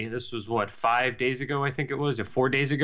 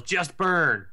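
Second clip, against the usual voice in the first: a mild mock yell, pitched up; higher-pitched retold shout; imitated yell; 7 semitones higher than usual. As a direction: call it out like a raider yelling over comms. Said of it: "someone just yells".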